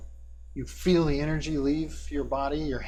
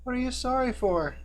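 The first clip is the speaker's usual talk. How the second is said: put-on high, puzzled boy's question; young boy; 10 semitones higher than usual. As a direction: ask as an innocent young boy. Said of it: "a familiar voice of a young boy".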